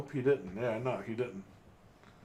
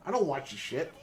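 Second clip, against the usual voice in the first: dismissive; 7 semitones higher than usual. Retold or imitated. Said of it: "And I'm like".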